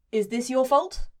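normal talking voice

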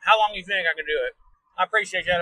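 ordinary talk

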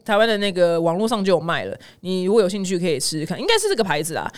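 normal talking voice

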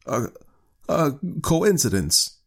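put-on slow, halting high-pitched old man; stammering. A narrator's reading. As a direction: falter on the first sound, unsure. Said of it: "stammered the old man".

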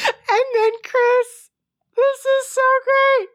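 high squealing laugh-talk, barely holding it together; words squeezed high through real laughter; cracking up. Real, not acted. Telling Chris a story that is so great, cracking up. Overcome retelling a funny story.

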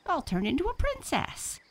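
high, loud, dainty princess voice. Pretend. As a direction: play a fairy-tale princess. Francine plays a princess.

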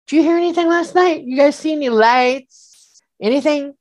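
high, fast eager questions; pressing mom for answers, wide-eyed; anxious questioning. Retold or imitated. Son pressing his mom about lights, high and eager.

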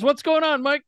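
dad's surprised question, voiced high; imitating dad. Dad picking up the phone to his son, lightly imitated high voice. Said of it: "He goes".

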